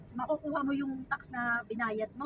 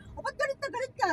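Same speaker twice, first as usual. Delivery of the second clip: retold challenge; 10 semitones higher than usual. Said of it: "Sabi ko sa kanya".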